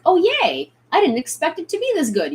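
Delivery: high, delighted cheer; pleasantly surprised. Acted. Imagined customer opening the clothes, pleasantly surprised. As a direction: exclaim it in happy surprise. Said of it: "get it and be like".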